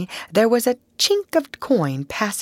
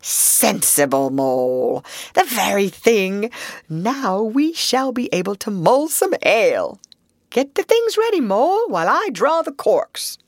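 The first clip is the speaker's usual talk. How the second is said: pleased approval, rising in pitch; praise, then cheerfully take charge; increasingly high, approving enthusiasm; 5 semitones higher than usual. A narrator's reading. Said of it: "he remarked approvingly".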